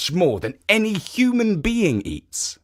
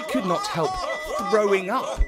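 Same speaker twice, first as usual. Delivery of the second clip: higher, laughing through the narration; laughing while telling; 7 semitones higher than usual. A narrator's reading. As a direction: let the laughter spill into the telling. Oni burst out laughing.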